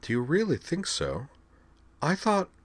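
put-on high, sweetly composed young noblewoman; Xenia's composed voice. A narrator's reading. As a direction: keep it high and poised while masking anger. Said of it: "she was all composure".

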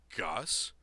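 blurted out loud in surprise; a sudden startled call of a friend's name; surprised blurt. A narrator's reading. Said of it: "Corwin blurted".

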